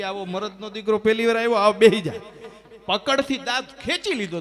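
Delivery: booming, delighted doctor, voice pushed up; hearty loud praise. Acted. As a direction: boom it out like a thrilled dentist. Doctor praising brave patient loudly.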